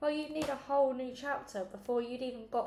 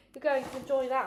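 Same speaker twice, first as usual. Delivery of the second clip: teasing; 4 semitones higher, 8 dB louder than usual. Acted. Tracy teasing Alexander.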